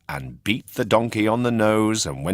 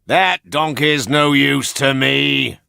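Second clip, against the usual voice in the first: higher, louder, slow scornful ringmaster; gruff ringmaster; 8 semitones higher, 7 dB louder, slower than usual. A narrator's reading. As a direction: bark it like a fed-up circus boss. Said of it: "said the ringmaster".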